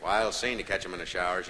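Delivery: usual speaking voice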